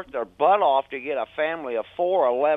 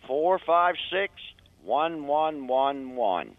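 slow, careful dictation of digits; slow number readout; slower than usual. Shown as a demonstration. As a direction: read each digit out slowly. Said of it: "why don't you give that number again".

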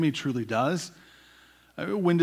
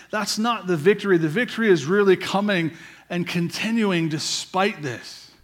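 rising, louder sermon climb; full-force preaching; 4 semitones higher, 7 dB louder than usual. Acted. Pastor preaching to his church, loud and rising. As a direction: drive the point home to the congregation.

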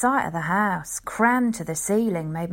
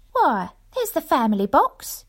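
higher, louder wife's voice with a rising lift; wife, noticing; 8 semitones higher, 4 dB louder than usual. Acted. Wife spotting the private box, eager higher voice. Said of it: "she said".

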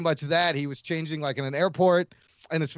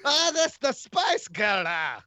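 higher, slow mock Scottish gone wrong; overdone Scottish accent; 5 semitones higher, slower than usual. Shown as a demonstration. Podcaster overdoes the accent trick, hammed-up Scottish. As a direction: let the Scottish accent run away. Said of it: "it's going slightly wrong now".

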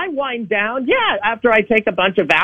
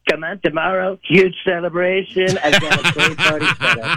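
lower, boastful Trump voice; Donald Trump impression; 3 semitones lower than usual. A caller playing Donald Trump for the hosts, boastful and lower. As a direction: brag like Trump on a rally stage. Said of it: "It's Donald Trump".

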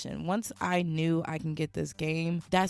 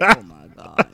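much lower, louder groan of disbelief; disbelieving oh my God; 9 semitones lower, 13 dB louder than usual. Retold or imitated. Hearing the clerk's pickup line, groaning in disbelief. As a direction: drop low and groan it out. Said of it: "And then I'm just like".

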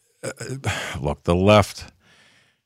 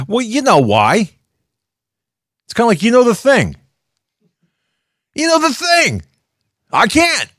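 mock Biden voice; 5 dB louder than usual. Neighbor's excuse voiced mockingly.

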